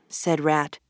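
plain reading voice

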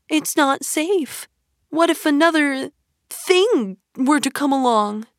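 fretful little Mole pleading; lightly voiced timid Mole; worried. A narrator's reading. Mole worries about Toad.

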